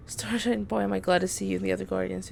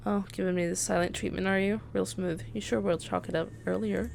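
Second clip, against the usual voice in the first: normal talking voice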